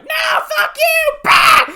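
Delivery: very high, loud mock screech; hammed-up defiant shout. Reviewer imitates the asshole Fae, screechy mock defiance. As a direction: screech it like a rude, bratty creature.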